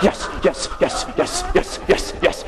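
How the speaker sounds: breathy, unvoiced rapid-fire yeses; whispered self-hyping chant; Tony Robbins. Motivational speaker psyching himself up before the jump, whispered hype. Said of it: "doing my little Tony Robbins".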